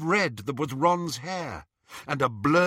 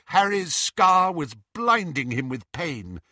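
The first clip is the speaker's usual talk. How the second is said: ordinary delivery, nothing marked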